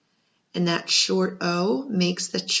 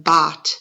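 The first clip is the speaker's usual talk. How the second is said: "bot" said alone, louder, short o held clear; first syllable alone; 2 semitones lower, 8 dB louder than usual. Shown as a demonstration. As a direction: isolate "bot" with a firm beat. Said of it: "we hear in that first part".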